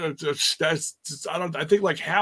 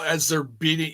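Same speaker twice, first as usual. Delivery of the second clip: normal talking voice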